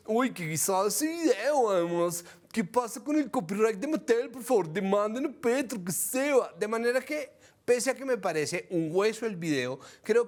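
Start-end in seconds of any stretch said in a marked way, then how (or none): 0.1-6.5 higher, put-on Bogotá gomelo accent; mock Colombian gomelo accent. Host mimics gomeles sneering at the video, mock gomelo accent. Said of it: "los gomeles están todos".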